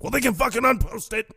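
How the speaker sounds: high-pitched indignant retort; strained, high voice of angry disbelief; mock outrage. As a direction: imitate an exasperated outburst. Acted quarrel over posted schedule.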